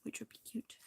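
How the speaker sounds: whisper. Real, not acted. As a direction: breathe it out as a private thought. Quiet aside on options.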